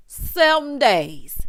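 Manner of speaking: "Seven" punched, slow and emphatic; slow emphatic "Seven days"; stress on Seven. Real, not acted. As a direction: lean on "Seven". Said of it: "stress on seven".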